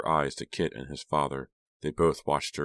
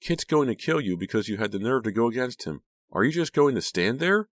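high, urgent challenge; pitched-up, insistent yell; shouting; 8 semitones higher than usual. A narrator's reading. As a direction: shout it in desperate disbelief. Shouted warning continues after narration.

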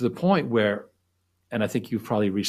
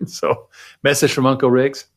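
louder, booming opening of a message; announcer-style message; 3 semitones higher, 8 dB louder than usual. Real, not acted. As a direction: announce it like a big shout-out. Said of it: "if you could call yourself Uncle Riggs".

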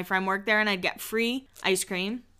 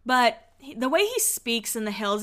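usual speaking voice

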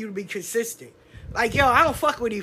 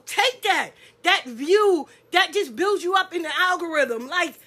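ordinary talk, nothing marked

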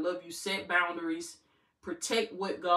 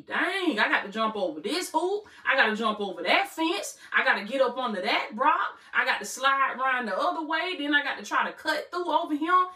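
voicing the enemy; 6 semitones higher, 5 dB louder than usual. Enemy voiced hitting obstacles.

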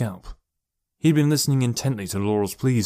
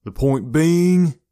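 loud, drawn-out, higher-pitched retort; bored reply; 6 semitones higher, 6 dB louder, much slower than usual. A narrator's reading. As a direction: stretch out BEING slowly and loudly. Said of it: "came the bored reply".

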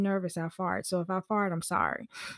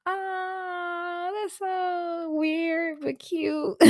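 high, slow, fond "aww" reaction; touched and amused; 9 semitones higher, much slower than usual. Retold or imitated. Reacting to the family's sweet babysitting ask, fondly amused. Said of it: "it was so cute".

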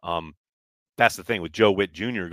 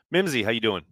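ordinary talk, nothing marked

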